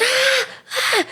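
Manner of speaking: very high, soft mock screams; small, squeaky retold screams; imitated screaming. Mimicking the man under the wheel, softened mock screams.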